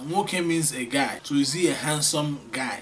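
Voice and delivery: ordinary delivery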